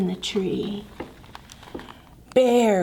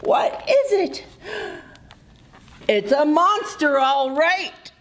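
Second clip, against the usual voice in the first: higher, scared voices; frightened cry; 5 semitones higher than usual. Acted. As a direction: cry out in fear. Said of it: "cried Moose".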